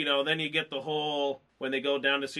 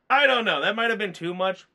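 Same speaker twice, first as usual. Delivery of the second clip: usual speaking voice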